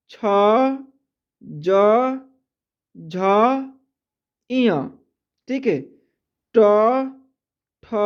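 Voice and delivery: slow, spaced-out letter recitation; reciting letters. Shown as a demonstration. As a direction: call each consonant clearly with pauses. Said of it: "व्यंजन में देखिए क्या होता है कि". (अक्षरों का धीमा उच्चारण)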